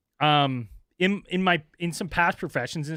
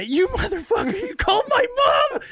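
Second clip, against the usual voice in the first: much higher, louder screeching rant; mock furious shriek; 5 dB louder than usual. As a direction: shriek it as the enraged caller. Angry caller voiced shrieking.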